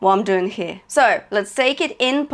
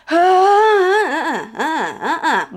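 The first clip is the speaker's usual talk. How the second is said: airy collapsed sigh, letting all the air out; breathy sigh demo; 8 semitones higher, 4 dB louder than usual. Shown as a demonstration. Coach demonstrating a breathy sigh to avoid. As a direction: show the wrong, breathy way. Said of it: "belting can't be breathy".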